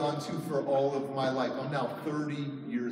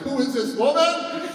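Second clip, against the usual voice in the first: high, loud mock-alarmed question; hammed-up bewildered yell; comic bewilderment; 9 semitones higher, 7 dB louder than usual. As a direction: cry it out in baffled disbelief, drawn out. Comic reaction to hormonal wife.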